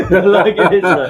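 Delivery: laughing through the words; high, loud laughing voice. Real, not acted. Laughing at own haggling story.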